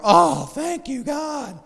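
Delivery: high, loud thanks to God; mock shout of relief. Preacher retells relief to congregation, shouting thanks. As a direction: blurt thanks skyward. Relief mom not looking.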